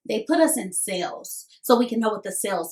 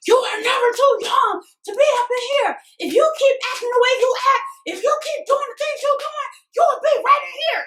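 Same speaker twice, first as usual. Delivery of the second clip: inmates shouting; 13 semitones higher than usual. Retold or imitated. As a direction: bellow it at the kids to scare them straight. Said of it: "And they were like".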